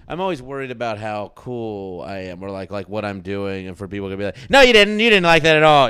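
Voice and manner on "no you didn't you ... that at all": high, loud imitation of an accuser; mock accusation. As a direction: call him out, pitched way up. Voices imagined people calling him out.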